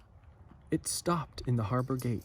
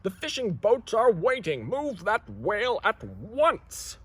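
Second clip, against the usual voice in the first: higher, slow, commanding; stern harbor master; 9 semitones higher than usual. Acted. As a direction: order them to move it now. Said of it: "shouted the harbor master".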